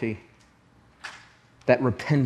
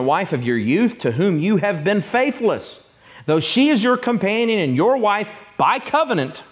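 raised, ringing pulpit reading of scripture; preaching scripture; 4 semitones higher than usual. Acted. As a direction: proclaim it as the voice of God. Reads God's words in Malachi.

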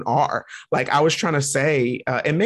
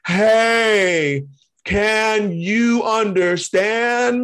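drawn-out, louder and higher mock talking-down; loud slow over-enunciation; 7 semitones higher, 4 dB louder, much slower than usual. Someone talking down to a non-native speaker, mock loud and slow. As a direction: overexaggerate slow loud words like to a foreigner. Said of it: "You talking to me like".

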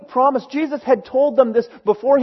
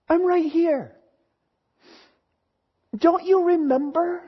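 higher and softer echo of the reassurance; Jesus softly repeating his reassurance to Mary, higher and quieter; 6 semitones higher, 4 dB quieter than usual. Pretend. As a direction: say it again, gently, almost hushed. Pastor voices Jesus comforting Mary.

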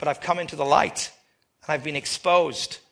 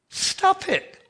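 sharp high command. Real, not acted. Preacher scolding whiners.

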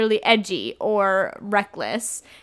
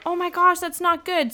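mock alarm; 8 semitones higher than usual. Voicing alarmed Bella in retelling.

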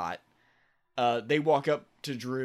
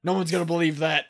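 loud, fast, higher director's voice; director impersonation; 4 semitones higher, 6 dB louder, faster than usual. Retold or imitated. Director scoffing at a script over the phone, loud rapid impersonation. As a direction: bark it like a movie director on a call. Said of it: "director impersonation for this whole thing".

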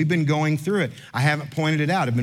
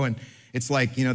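normal talking voice

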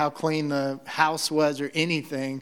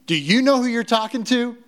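loud, much higher-pitched outrage; indignant rebuke; 8 semitones higher, 6 dB louder than usual. Acted. Imagined Lord rebuking Martha for her attitude, indignant. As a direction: put the upstart in their place, hammed-up.

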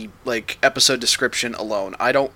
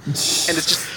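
ordinary delivery

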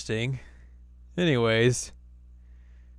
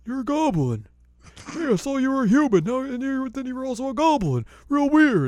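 higher, louder mocking old-man voice; teasing old man; 14 semitones higher, 6 dB louder than usual. Totosai teasing Inuyasha in a high mocking old voice. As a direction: call him a goblin in a high teasing old voice.

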